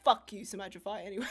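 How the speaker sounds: ordinary talk, nothing marked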